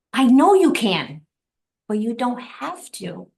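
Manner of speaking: slow, high repeat, starting loud and easing off; emphatic revelation. Retold or imitated. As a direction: marvel at each word, drawn out. Repeats husband's words as a revelation.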